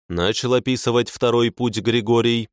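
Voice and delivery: ordinary delivery, nothing marked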